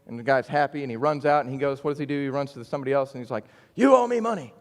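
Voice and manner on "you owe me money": sudden high, loud demand for money. Retold or imitated. Unmerciful servant loudly demanding his debt back, angry. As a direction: snap it at him like a greedy creditor.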